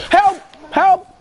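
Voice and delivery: high, loud cry for help; yelling for help. Real, not acted. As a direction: shout it out, urgently. Calling teammates for help.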